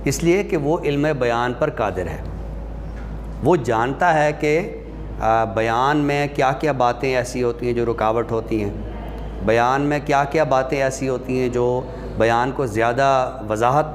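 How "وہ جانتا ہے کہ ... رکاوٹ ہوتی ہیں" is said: usual speaking voice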